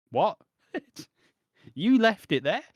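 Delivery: high, loud mock disbelief; mock surprise. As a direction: squeal in fake shock. Mocking the robbed friend.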